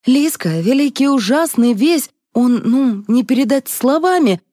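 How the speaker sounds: high-pitched, agitated warning; panicked outburst. A narrator's reading. Colleague warning Lizka about the boss, panicked. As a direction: gush it out in high alarm.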